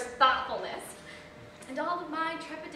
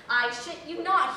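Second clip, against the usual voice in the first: ordinary talk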